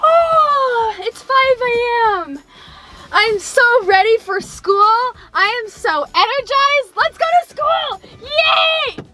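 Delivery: high, bubbly morning cheer; overly energized, squealing excitement; peppy high school me. Retold or imitated. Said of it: "Me in high school".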